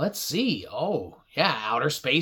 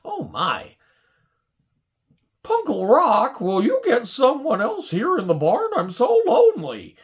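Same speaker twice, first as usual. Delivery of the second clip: lonely horse; 4 semitones higher than usual. Acted. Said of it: "He started getting lonely".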